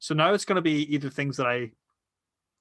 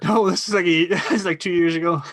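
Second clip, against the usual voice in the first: laughing, louder protest; 3 semitones higher, 4 dB louder than usual. Real, not acted. Friend laughs at being called younger. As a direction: laugh through the denial.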